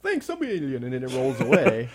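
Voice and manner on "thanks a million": high, chirpy little robot voice with a laugh; a cute pizza robot thanking you sweetly. Pretend. Pizza robot thanks its customer, high and chirpy. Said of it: "the little thing's like".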